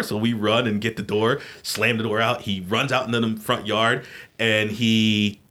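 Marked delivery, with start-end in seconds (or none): none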